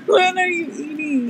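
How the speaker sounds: laughing through the words. Real, not acted. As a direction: giggle while asking. Laughing at friend's chicken bone.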